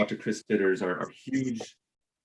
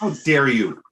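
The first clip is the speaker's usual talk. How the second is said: mock indignation; 4 semitones higher, 5 dB louder than usual. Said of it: "You'll have to say that in".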